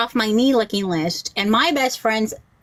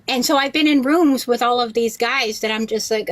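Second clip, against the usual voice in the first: ordinary talk, nothing marked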